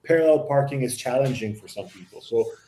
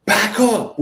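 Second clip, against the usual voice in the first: curt, loud and higher, no patience; impatient command; 7 semitones higher, 5 dB louder than usual. Retold or imitated. Driver telling him to back up, impatient and loud. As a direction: bark it like an annoyed stranger. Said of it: "with, like, no patience".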